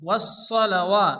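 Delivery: ওয়াসসালাওয়া said slowly with a heavy, full-mouthed স; heavy saad. Shown as a demonstration. Teacher showing the heavy সোয়াদ in ওয়াসসালাওয়া. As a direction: give the স in ওয়াসসালাওয়া a thick, heavy sound. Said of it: "সোয়াদকে মোটা করে উচ্চারণ করতে হয়".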